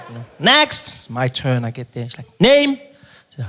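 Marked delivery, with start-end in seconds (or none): 0.4-0.9 loud high clerk call; calling next. Retold or imitated. 2.4-2.8 loud, high, curt demand; curt clerk. Retold or imitated.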